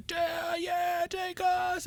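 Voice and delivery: high shouted "take us"; excited shout. Retold or imitated. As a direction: yell it like eager fans welcoming aliens. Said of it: "they're like".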